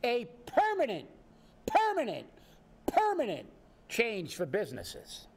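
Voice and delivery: indignant rising stress on permanent; building emphasis on permanent. Real, not acted. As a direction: hammer permanent harder each time.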